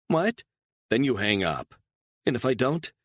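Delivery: high, sharp surprise; startled woman. A narrator's reading. Captive woman startled by his order, high and sharp. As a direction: jolt at the demand. Beatrice voiced by narrator.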